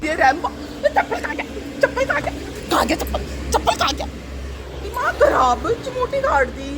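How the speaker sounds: high, sharp scolding of the dog over a missing slipper. Real, not acted. Woman scolding her dog Rambo for hiding the slipper, high and exasperated.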